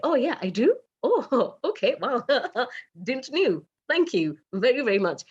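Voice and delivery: high, delighted surprise with little laughs; flattered surprise. Retold or imitated. Acts out flattered surprise at compliment.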